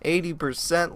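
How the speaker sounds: ordinary delivery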